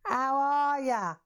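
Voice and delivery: high, drawn-out Cockney greeting; exaggerated Cockney. Shown as a demonstration. Speaker avoiding being labelled Australian, exaggerated Cockney. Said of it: "speaking like a right Cockney".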